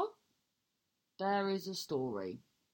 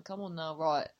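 ordinary talk, nothing marked

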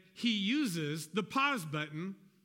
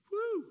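mock cheer. Cheering after blessed quote.

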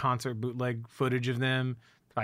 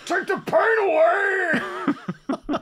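screamed high falsetto; mock falsetto scream; 9 dB louder than usual. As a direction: shriek it way up high. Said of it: "[name]".

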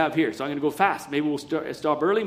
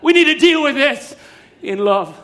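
high, loud call, softening at the end; 7 semitones higher, 5 dB louder than usual. Acted. God as father calling to his hiding children, loud. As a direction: call out, then land gently on love. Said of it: "he was calling out".

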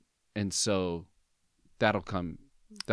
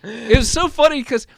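laughing while telling it, much higher; husband laughing while recalling wife's reaction, much higher and louder; 11 semitones higher, 10 dB louder than usual. Real, not acted. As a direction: get the words out through laughter.

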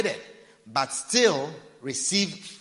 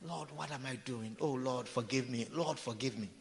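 much softer, lower murmured plea; mock guilty prayer; 4 semitones lower, 11 dB quieter than usual. Preacher mimicking a sinner praying mid-sin, softer and murmured.

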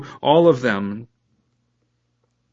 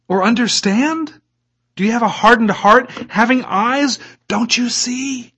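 impassioned rebuke; 9 semitones higher than usual. Acted. Jesus rebukes disciples, preached.

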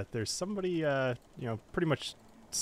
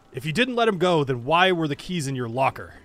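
loud accusing demand; angry captain; 3 semitones higher, 7 dB louder than usual. Acted. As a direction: shout the accusation at him. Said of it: "He says".

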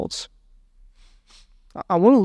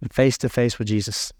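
ordinary talk, nothing marked

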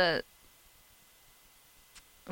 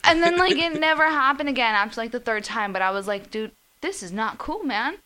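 agitated; 8 semitones higher, 7 dB louder than usual. Real, not acted. Retelling scary busts, voice rises.

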